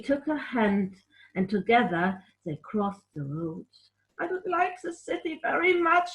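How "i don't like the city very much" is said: put-on much higher little girl voice; higher timid child's thought; Kim's small voice. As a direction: voice the scared child. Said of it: "Kim thought to herself".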